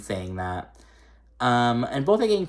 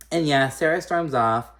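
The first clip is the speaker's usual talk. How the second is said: usual speaking voice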